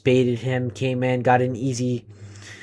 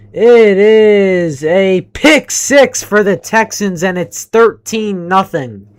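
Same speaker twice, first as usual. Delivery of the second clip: higher, louder, slowed interception call; dramatic play-by-play; 6 semitones higher, 9 dB louder, slower than usual. Real, not acted. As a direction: stretch out the pick-six call.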